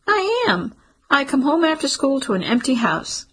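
put-on a girl's high voice, insisting hard; young daughter, high and insistent, pushing back. A narrator's reading. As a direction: pitch it up high like a stubborn kid protesting. Child Lana insists.

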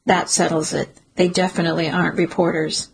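ordinary delivery, nothing marked

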